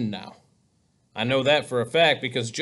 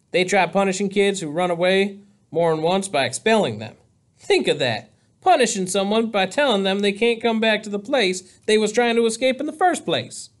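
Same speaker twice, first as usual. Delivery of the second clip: put-on higher, animated boy's voice; lively, pitched-up character voice; Possum, amused storyteller; 6 semitones higher, 4 dB louder than usual. A narrator's reading. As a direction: tell it as a great joke on the school.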